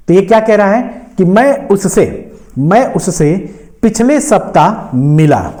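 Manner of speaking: normal talking voice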